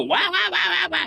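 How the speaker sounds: high, yapping mock cheer on wah; wrong stadium cheer. Teacher mimics what fans don't do, mock cheer. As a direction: yap out the wrong kind of whoop. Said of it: "You don't go".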